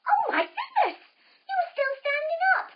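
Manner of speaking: high, loud astonished cry; playful teacher gasping in wonder at a child; amazed. Acted. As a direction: act thrilled the tree still stands. Mock amazement at strong tree.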